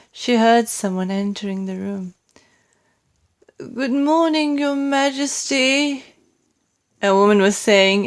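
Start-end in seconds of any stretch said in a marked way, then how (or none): 3.6-6.0 a lightly voiced older maid, higher; oldish maid. A narrator's reading. Maid greeting the Queen at breakfast, lightly voiced and higher. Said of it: "the voice of an oldish person".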